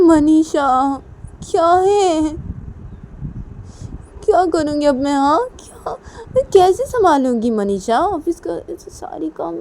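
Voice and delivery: high, plaintive, drawn-out protest; overwhelmed whining. Acted. Nisha to Manisha about the office work, helpless whine. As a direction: wail helplessly at the burden.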